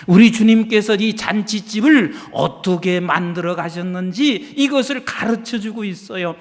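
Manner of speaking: ordinary talk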